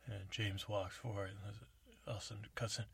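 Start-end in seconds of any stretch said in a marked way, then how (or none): none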